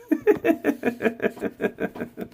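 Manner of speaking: high, soft, airy laugh. Real, not acted. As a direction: let out a light breathy laugh. Laugh after rousing the audience.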